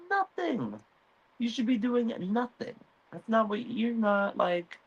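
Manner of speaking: high, cold mother voice; scolding Alicent. Retold or imitated. Alicent scolds Aegon in high cold voice. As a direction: lecture him from above. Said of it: "She's like".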